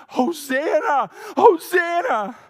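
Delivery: high, full-throated shout of praise; retold ecstatic scream, very loud and high; screamed Hosanna. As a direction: cry it out like a crowd in worship. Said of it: "they start screaming".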